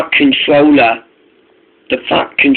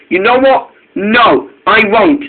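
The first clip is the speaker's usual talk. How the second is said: cross; 7 semitones higher than usual. Acted. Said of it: "Norman was very cross".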